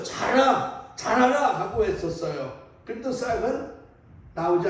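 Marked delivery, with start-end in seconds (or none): 0.0-1.6 short, high acted shouts; shouting. Toad shouts at the seeds once more, shouting. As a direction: cry it out as a raised command. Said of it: "또 한 번 외쳐".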